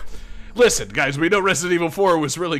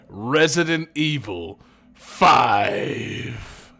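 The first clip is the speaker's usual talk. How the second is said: slow booming game announcer; drawn-out Resident Evil five shout; much slower than usual. Retold or imitated. Host does game title announcer.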